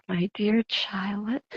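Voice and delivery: drawn out and hushed, a lightly voiced dad; imitating dad's reply. A daughter mimicking her puzzled dad, slow and hushed. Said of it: "It's always that, like".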